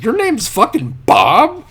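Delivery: loud incredulous question. Retold or imitated. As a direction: stretch it out in drunken shocked disbelief. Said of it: "I was like".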